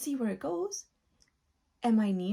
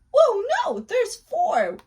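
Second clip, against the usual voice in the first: surprised shout; 9 semitones higher, 11 dB louder than usual. Acted. Surprise at extra doors.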